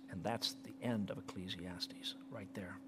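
hushed unvoiced whisper; softly whispered close. Real, not acted. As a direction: drop to a reverent whisper.

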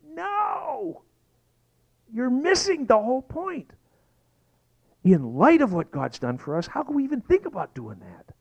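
high, crying voice of an outraged apostle; Paul pleading in disbelief; Paul screaming. Retold or imitated. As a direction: apostle crying out at his readers. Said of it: "he is literally screaming".

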